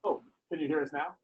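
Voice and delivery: faint audio. Real, not acted. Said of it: "you're a little faint".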